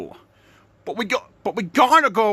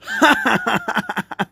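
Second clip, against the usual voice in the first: laughing; 6 semitones higher than usual. Real, not acted. Laughing at own joke.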